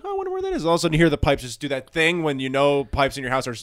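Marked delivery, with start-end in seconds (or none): 0.0-0.7 high, soft fake-innocent wondering; mock innocent. Friend imitating Measy sneaking to the forbidden toilet, innocent. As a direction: play dumb in a tiny high voice. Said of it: "off old M C Mees went".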